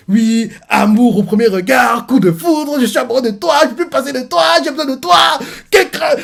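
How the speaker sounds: loud, high, overblown lover's plea; mock declaration of love. Reader parodies the lying suitor's wooing, mock passion. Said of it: "Il fait croire".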